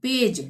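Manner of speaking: पेज ending in a j sound; correct soft g. Shown as a demonstration. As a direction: say page as पेज, g like j. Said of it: "ज का ही उच्चारण करेंगे".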